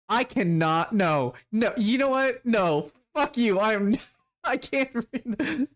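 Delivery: high, incredulous protest breaking into a laugh; exasperated refusal. Real, not acted. Reader who can't read the text, laughing exasperation. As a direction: give up mid-read, pitch way up, stretching it out. Gives up reading aloud, laughing.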